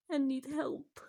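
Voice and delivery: normal talking voice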